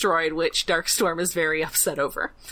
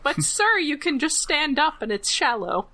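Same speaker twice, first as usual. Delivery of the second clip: mock servant voice; 7 semitones higher than usual. Mortdred answering his boss.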